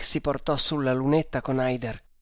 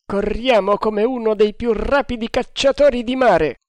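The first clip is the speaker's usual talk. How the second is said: proud exclaim; 9 semitones higher, 6 dB louder than usual. A narrator's reading. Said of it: "disse il quartier mastro".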